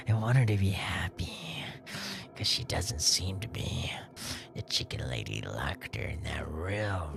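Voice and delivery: whispered confession, breathless and hushed; secret whisper. Acted. Violin boy confiding his secret to the stranger, whispered. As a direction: whisper it so the chicken lady can't hear.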